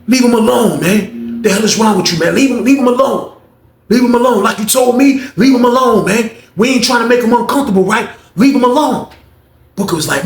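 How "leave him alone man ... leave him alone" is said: high, loud acted protest; heated defending. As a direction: act out stepping in for someone.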